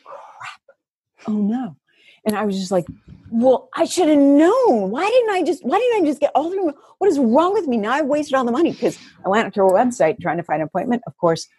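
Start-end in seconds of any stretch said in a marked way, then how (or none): 0.0-1.8 whispered dismay. Retold or imitated. Realizing package expires. 3.3-8.7 exasperated self-blame. Retold or imitated. Retold self-blaming rant.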